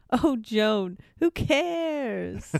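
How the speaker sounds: high, dismissive mock-highbrow drawl; airy high scoff; Showalter line read. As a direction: toss it off like a bored literary snob. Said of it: "And Showalter goes".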